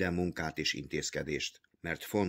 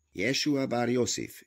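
impatient repeat; 6 semitones higher than usual. A narrator's reading. Jehuda repeating the name to the treasurer, impatient. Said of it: "ismétli Jehuda türelmetlenül".

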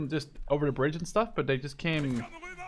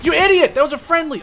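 much higher, loud angry yell; furious shout; 15 dB louder than usual. Real, not acted. Gamer shouts at teammate over friendly kill, furious. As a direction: yell at the careless player.

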